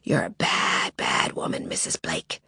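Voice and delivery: soft, low, hissed threat; masked intruder taunting Lily, whispered and menacing. Acted. Sinister whispered taunt.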